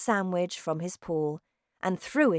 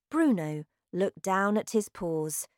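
usual speaking voice

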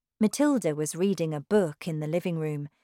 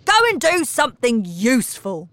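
angry shout; 9 semitones higher, 8 dB louder than usual. A narrator's reading. Mister Wormwood yelling at Matilda, loud. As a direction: rant at her.

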